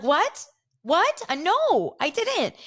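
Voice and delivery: high-pitched mock disbelief; squeaked-up indignant denial; Whitney. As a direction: imitate a flustered castmate protesting. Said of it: "And Whitney's like".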